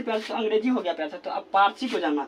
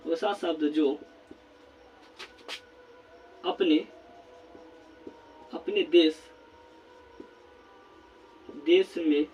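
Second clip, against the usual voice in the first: slow dictation; 5 semitones lower, 4 dB quieter, slower than usual. Shown as a demonstration. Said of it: "तो यहाँ पर लिखेंगे प्यार साथियों".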